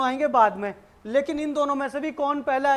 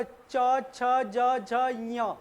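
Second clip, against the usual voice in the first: च छ ज झ ञ spoken slowly, each letter apart, tongue up to the palate; slow letter demo; much slower than usual. Shown as a demonstration. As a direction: show the class च छ ज झ ञ one by one. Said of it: "देखो अब".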